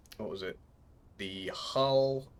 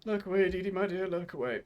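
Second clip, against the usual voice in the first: mock plea; 10 semitones higher than usual. Tells viewer to look away.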